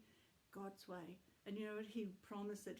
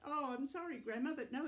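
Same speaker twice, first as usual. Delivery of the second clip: plain reading voice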